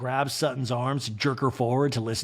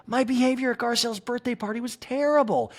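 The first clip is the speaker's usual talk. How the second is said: higher mock Kyle voice; Kyle impression; 10 semitones higher than usual. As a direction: confess it, pitched up. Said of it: "Kyle's like".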